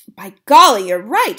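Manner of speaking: loud delighted outburst; grandmother cries out. Acted. Grandmother realizes the boy is right, loud cry. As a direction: burst out with sudden agreement. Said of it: "she cried".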